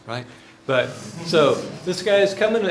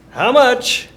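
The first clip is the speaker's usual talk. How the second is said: alarmed wife; 11 semitones higher, 10 dB louder than usual. Retold or imitated.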